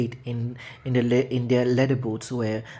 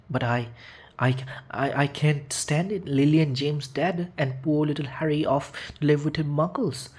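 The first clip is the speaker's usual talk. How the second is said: higher, breaking tearful voice; tearful sobbing; 4 semitones higher than usual. A narrator's reading. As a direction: choke it out through tears. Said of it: "sobbed Hagrid".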